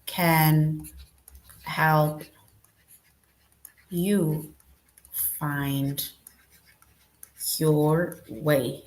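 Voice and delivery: each word set apart with long pauses; teacher dictating a sentence word by word. Shown as a demonstration. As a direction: space the words out one at a time. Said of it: "we can start with a capital letter M".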